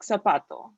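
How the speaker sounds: zapato starting with an S sound. Shown as a demonstration. Tutor showing the Z letter, zapato with S. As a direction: say zapato as "sah-pah-to". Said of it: "like, an S sound".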